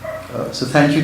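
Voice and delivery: normal talking voice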